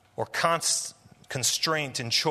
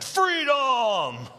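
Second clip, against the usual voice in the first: much higher Scottish battle shout, louder; William Wallace battle cry; 10 semitones higher, 4 dB louder than usual. Retold or imitated. William Wallace rallying his troops, loud Scottish battle shout. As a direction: roar it like a Scottish rebel. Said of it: "William Wallace shouting in his Scottish accent".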